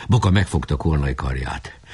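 ordinary delivery, nothing marked

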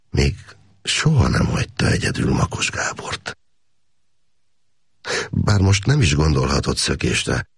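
plain reading voice

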